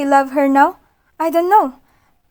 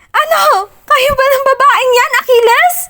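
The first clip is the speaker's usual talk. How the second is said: high, loud, near-sobbing yell; tearful shout; 12 semitones higher, 6 dB louder than usual. Acted. Heroine demanding if he's with Alana, tearful shout. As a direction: cry out the accusation.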